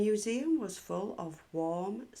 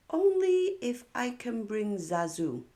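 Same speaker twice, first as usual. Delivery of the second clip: put-on higher, louder girlish bargaining; a higher, playful little-girl voice; Mimi's voice; 7 semitones higher, 6 dB louder than usual. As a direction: Mimi setting her condition. Mimi bargains.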